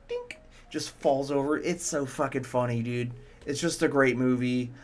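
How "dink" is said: dink sound effect. Retold or imitated. Imitating train knocking over.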